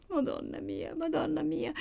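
high, soft tearful moan; weeping. A narrator's reading. Maddalena, face in her hands, weeping. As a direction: whimper the invocation high and small. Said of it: "seguitava a piangere Maddalena".

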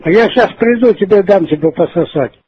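ordinary talk, nothing marked

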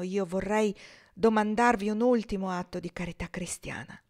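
steady reading of a novel aloud; story narration. A narrator's reading. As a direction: tell the story evenly to listeners.

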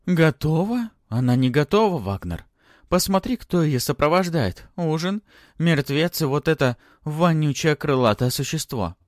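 loud, high-pitched outrage; indignant scolding. A narrator's reading. Stepmother complains to the baron about the guests, indignantly.

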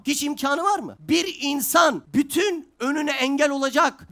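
loud, slow sermon delivery, half shouting; a preacher thundering at the congregation; fiery preaching. Acted. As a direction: preach it to the room with full force. Preaching at full force.

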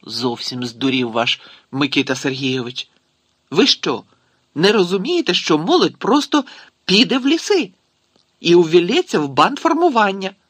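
indignation building to high, loud questioning; berating someone over an idiotic plan; exasperated outburst. A narrator's reading. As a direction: start contained, then climb into loud disbelief. Character rants at foolish policy.

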